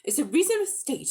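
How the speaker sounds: high, mocking spiteful jab. A narrator's reading. Polly spitefully mocking the witch, high and cutting. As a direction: throw her words back at her. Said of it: "said Polly spitefully".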